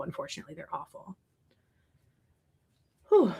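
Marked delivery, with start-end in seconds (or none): 0.0-1.2 sudden hushed whisper; whispered aside. Real, not acted. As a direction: drop to a breathy whisper as an aside. Muttering after a mosquito rant.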